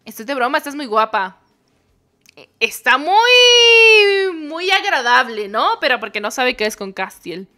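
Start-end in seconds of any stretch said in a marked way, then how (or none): 2.4-5.5 high, loud, very slow sugary drawn-out voice; mock sweet. Streamer mocking the friend's compliment, fake sweet drawl. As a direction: stretch it out with fake politeness.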